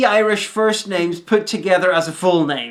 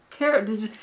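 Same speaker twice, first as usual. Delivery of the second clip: scandalised. Real, not acted. Defending John Williams to a co-host, scandalised. Mock outrage at slight to composer.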